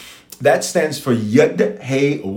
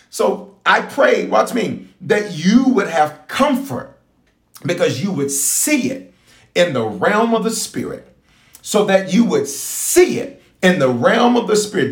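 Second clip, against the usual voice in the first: slow, higher prayer cadence; praying over people; 6 semitones higher than usual. Acted. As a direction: pray it over the crowd, drawing it out.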